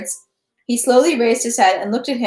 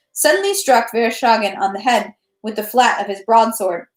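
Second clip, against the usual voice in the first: ordinary delivery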